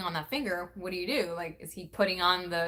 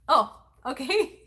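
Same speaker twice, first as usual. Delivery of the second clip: amused pressure; 6 semitones higher, 6 dB louder, slower than usual. Real, not acted. Reacting to daughter, laughs.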